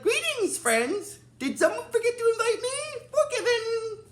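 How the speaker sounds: very high, comic character voice; squeaky, jovial impression in a falsetto; Ninjor. Imitating Ninjor greeting friends who left him out, high comic voice. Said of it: "he makes a bit of a joke".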